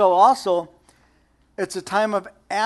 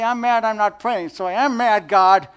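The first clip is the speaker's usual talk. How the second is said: bold admission; 4 semitones higher, 6 dB louder than usual. Pretend. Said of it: "Don't wimp out and say".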